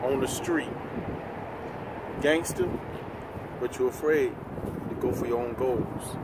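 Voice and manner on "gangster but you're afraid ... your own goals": ordinary talk, nothing marked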